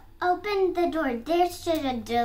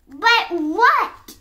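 loud, higher impatient demand; growled; 5 semitones higher, 9 dB louder than usual. A narrator's reading. Fox cutting in on the piglet, growling. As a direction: growl it back at the interruption. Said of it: "growled the fox".

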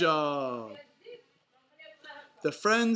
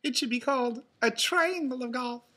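high, loud cartoon lady duck; Daisy's amused voice; 9 semitones higher, 5 dB louder than usual. A narrator's reading. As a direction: crow it in a high character voice. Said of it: "laughed Daisy".

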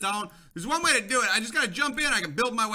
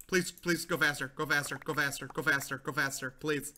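urgent low pleading; 7 semitones lower, 6 dB quieter than usual. Real, not acted. Urgent pleading under water.

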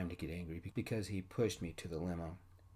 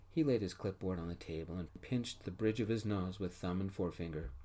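ordinary delivery, nothing marked

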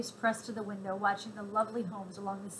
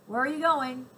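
stern soldier asking; 4 semitones higher, 8 dB louder than usual. A narrator's reading. Soldier questioning passengers.

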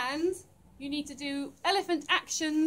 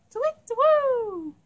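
high, loud owl hoot; big swooping owl call; 9 semitones higher, 7 dB louder than usual. Pretend. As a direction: hoot like an owl, falling away. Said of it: "Hoot like an owl".

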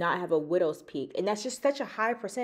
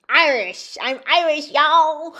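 loud, hammed-up Irish accent, pitched up; mock Irish accent; 8 semitones higher, 15 dB louder, slower than usual. Reading her DNA results to viewers, a loud mock Irish accent. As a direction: shout it like a stage Irishman, half joking. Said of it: "I don't know what kind of accent that was".

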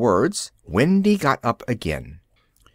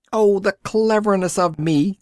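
a touch of cocky glee; joyful crowing; 7 semitones higher than usual. A narrator's reading. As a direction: crow it out, thrilled with himself. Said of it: "cried Peter, overcome with joy".

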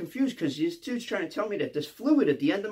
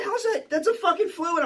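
very high-pitched disbelief; freaking out; 11 semitones higher than usual. Retold or imitated. Inmate keeps disputing the guard's answer, freaking out. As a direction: argue it in a high, incredulous voice. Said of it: "I'm freaking out".

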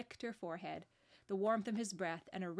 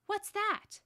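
high, loud startled cry; alarmed child's outburst; 12 semitones higher, 8 dB louder than usual. A narrator's reading. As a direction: jump at a frightening noise. Lucy startled by loud noise.

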